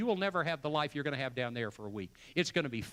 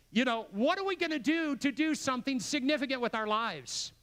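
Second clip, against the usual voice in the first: rousing challenge; 8 semitones higher than usual. Real, not acted. Preacher's climactic challenge.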